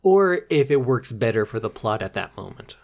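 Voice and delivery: hushed voiceless whisper. Real, not acted. Answering his cohost's whisper in a whisper. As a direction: whisper the joke back.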